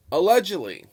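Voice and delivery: "Allegedly" punched loud and high, then falling; emphatic allegedly. Real, not acted. Vlogger stressing his fairness to viewers, emphatic allegedly. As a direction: lean hard on "Allegedly", pitch leaping up. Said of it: "I emphasize the word allegedly".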